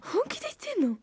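can't believe it; incredulous. Real, not acted. Disbelief at the name.

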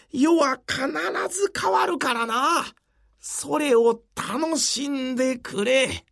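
aged samurai passing on his hope; creaky wise old man. Acted. Yoshimura's dying speech.

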